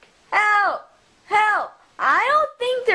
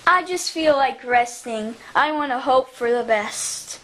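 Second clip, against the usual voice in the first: ordinary delivery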